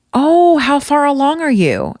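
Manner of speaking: high, drawn-out cooing question; mimicking an over-interested acquaintance; mock gushing question. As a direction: imitate a gushing busybody, slow and high. Acting out nosy woman's question.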